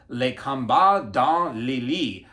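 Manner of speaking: slow, careful stab at French; uncertain French pronunciation. Real, not acted. Vlogger attempting a French film title, uncertain French pronunciation. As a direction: sound out the French title slowly, unsure of it. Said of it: "I'm probably totally pronouncing all of that wrong".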